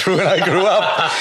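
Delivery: laughing. Real, not acted. Laughing about Billy Connolly.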